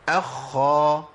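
اخا with the خ held long before the a; very slow اخا, held whispery خ; خاء مشددة مفتوحة; doubled kha, fatha. Shown as a demonstration. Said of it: "مع المشدد بقى".

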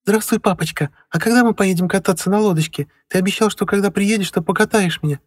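put-on high child's voice, no pauses; breathless little boy. A narrator's reading. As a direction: blurt it all in one breath. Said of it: "Ребёнок выдохнул её за раз".

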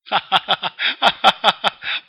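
high, loud cackle; Joker's loud high cackle; loud cackling laugh. Acted. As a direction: burst into a maniacal laugh. Joker laughs at his joke.

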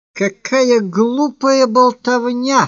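high, drawn-out exclamation; indignant cry. A narrator's reading. Woman dismisses her admirer's vows with a high, indignant cry. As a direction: cry it out slowly, pitch well up. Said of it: "вскричала она".

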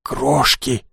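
Крошки uttered with mock despair; tragic. A narrator's reading. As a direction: say Крошки like a dramatic tragedy. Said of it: "трагически повторила".

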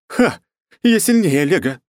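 high, loud barked boast; barking. Acted. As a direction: bark it as you charge. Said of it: "рявкнула она". (рявкнуть)

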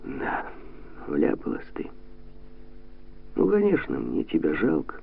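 drained, halting delivery with long pauses; deflated and soft, all energy gone; limp, empty voice. A narrator's reading. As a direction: let the words fall out lifelessly. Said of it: "вялым, пустым голосом произнёс".

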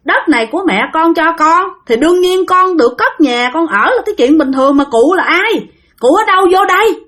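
put-on father pushing back at the old man, baffled and indignant; indignant retort. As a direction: question the stranger, voice climbing at the end. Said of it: "Thì ba mới nói rằng là".

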